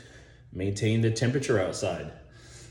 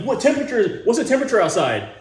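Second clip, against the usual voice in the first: mock Keanu line; 11 semitones higher, 6 dB louder, faster than usual. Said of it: "Keanu Reeves is like".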